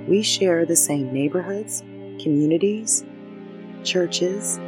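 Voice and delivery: calm unhurried meditation voice with pauses; guided meditation. Acted. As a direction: guide them gently through each breath. Leads a breathing meditation.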